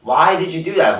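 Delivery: normal talking voice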